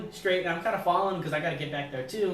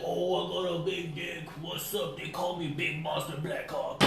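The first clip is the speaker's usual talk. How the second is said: lazy imitation of a boastful dude; mock bragging bro; 2 semitones lower, 6 dB quieter, slower than usual. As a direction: mimic a cocky guy bragging, slowed down. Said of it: "everybody's always like".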